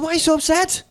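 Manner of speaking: high-pitched baffled question; mock incredulous. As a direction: act out bewilderment, voice jumping way up. Retold baffled question to friend.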